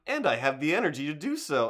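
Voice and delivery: sudden burst of excitement; excited. Retold or imitated. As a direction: gasp it out, thrilled. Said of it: "I was just like".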